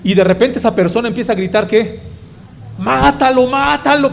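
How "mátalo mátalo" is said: high, bloodthirsty wrestling-fan yell. Retold or imitated. Fan at the lucha libre screaming at the fighter, bloodthirsty. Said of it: "esa voz así de un deseo auténtico de ver sangre".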